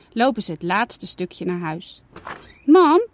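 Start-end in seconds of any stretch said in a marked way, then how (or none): 2.7-3.1 louder, higher call for mom. Acted. Janna calling mama as she comes in, louder call. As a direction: shout it through the house. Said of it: "roept Janna".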